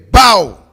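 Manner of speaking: loud shouted gunshot bang; imitated pistol shot. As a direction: bark out a starter's pistol.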